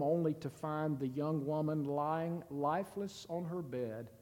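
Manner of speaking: ordinary talk, nothing marked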